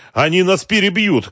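high, urgent cry; alarmed shout. A narrator's reading. Adviser warning under gunfire, alarmed shout. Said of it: "крикнул Дронго".

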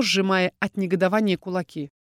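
ordinary delivery, nothing marked

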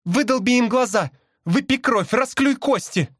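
high, bloodthirsty urging; fierce urging cry. A narrator's reading. Sister bird demands an attack, crying fiercely. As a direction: egg him on, pitched high. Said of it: "крикнула мне сестра".

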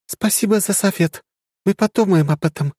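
high, cool polite reply; reserved politeness; restrained young woman. Acted. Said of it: "сдержанно ответила Ариэль".

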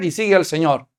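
usual speaking voice

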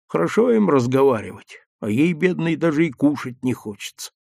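plain reading voice

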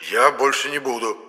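высокий голос куклы, клятвенно; пообещай как провинившийся; клятвенное обещание. A narrator's reading. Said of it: "клятвенно пообещал Епифан". (клятвенное заверение повыше)

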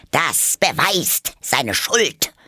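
high, loud, slow verdict; triumphant queen. Acted. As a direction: pronounce guilt like a gloating queen. Queen condemns.

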